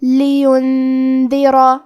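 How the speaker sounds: لیندرا recited slowly with the نون hidden in a held nasal ghunna; slow chanted لیندرا with a humming nasal نون. Shown as a demonstration. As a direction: stretch the ghunna in لیندرا before the د. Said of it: "یہ حکم کو غنہ کے ساتھ ادا کریں گے".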